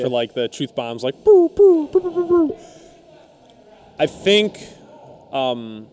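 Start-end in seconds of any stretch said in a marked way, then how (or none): 1.0-2.6 booing. Pretend. Mock booing the truth bombs.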